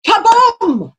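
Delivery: high, booming kaboom; sudden dramatic sound effect; imitated gunshot. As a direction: burst out with a storybook bang. Imitating the starting gun shot.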